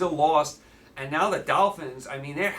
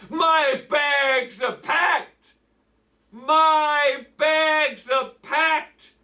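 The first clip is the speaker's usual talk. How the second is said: sarcastic hype shout; 9 semitones higher, 4 dB louder, much slower than usual. Acted. Sarcastic hype for bad game.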